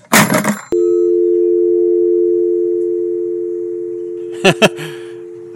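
long high villain laugh, loud; evil laugh. Acted. The menacing caller gloating at the scammer with a high villain laugh. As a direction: cackle like a triumphant villain.

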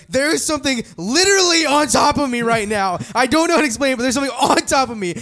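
very high, loud frightened outburst; acted panic. Friend in bed warning Caleb of something above him, panicked. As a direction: cry out in retold terror, voice way up.

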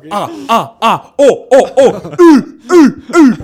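loud, high vowel drill; shouted class recitation. Retold or imitated. Imitating the class reciting the alphabet, shouted drill. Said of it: "il faisait répéter plusieurs fois ... on criait".

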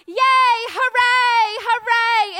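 very loud, high cheer; loud festive cheering; yay hooray. Acted. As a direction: shout it like a party. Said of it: "less of a".

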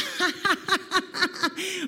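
a mocking man's laugh, drawn out; risada debochada. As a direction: laugh at the kid's ugly kangaroo. Homem ri da criança. (gargalhada debochada de homem)

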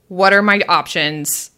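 usual speaking voice

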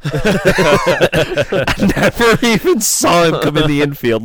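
slow words through real laughter; laughing while talking. Real, not acted. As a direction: laugh at his own blunder as he says it. Laughing at his own miss.

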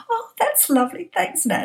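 high, put-on delight for the boss watching; mock delight. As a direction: fake thrilled thanks, pitched high. Faking delight at present.

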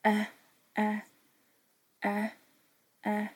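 A said as a short a, a, a; short a sound repeated. Shown as a demonstration. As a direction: tap out the A sound. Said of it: "phonics sound demo".

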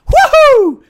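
loud, high, slow cheer; gleeful woohoo. Real, not acted. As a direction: whoop with joy.

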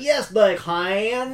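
thick mock Southern drawl on "Yes, they can"; exaggerated Southern accent. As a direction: stretch every word like a hammed-up Southern movie actor. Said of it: "accentuate those words too far".

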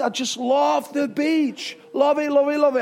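high gush, drawn out then rapidly repeated; over-the-top playful enthusiasm; exuberant adoration. Real, not acted. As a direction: build to a giddy burst of love. Climax of gushing about the beach.

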